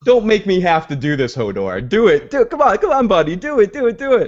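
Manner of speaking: high, insistent hammed-up coaxing; mock pleading. Bran coaxing Hodor to act, mock urgent pleading. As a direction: climb into a squeaky-high, playful beg.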